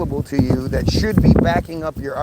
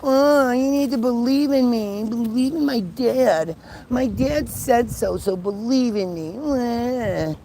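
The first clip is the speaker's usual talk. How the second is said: high, slow, whiny mocking voice; whiny Jesus voice; 5 semitones higher, 3 dB quieter, slower than usual. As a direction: whine it like a spoiled child. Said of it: "he's like a whiny little bitch".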